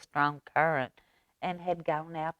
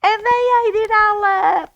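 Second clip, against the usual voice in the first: very high, louder squeak; high squeaky voice; 9 dB louder than usual. Acted. Baby Bear complaining the soup is gone, high squeak. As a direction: squeak it like a tiny bear cub. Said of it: "in her high, squeaky voice".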